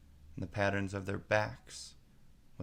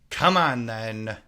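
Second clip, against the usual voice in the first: roared; 11 dB louder, slower than usual. A narrator's reading. Queen roars at Alice to join. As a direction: roar the summons. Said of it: "roared the queen".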